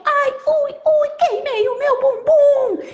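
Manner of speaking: very high, drawn-out comic yelp; hammed-up squeal of pain; scalded wolf. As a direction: wail like a cartoon wolf with a burnt bottom. Burnt wolf cries in pain.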